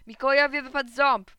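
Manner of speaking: loud, high schoolboy yell; shouted announcement. A narrator's reading. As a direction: proclaim the news to the whole yard. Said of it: "krzyknął Alces". (głośny, wysoki okrzyk chłopaka)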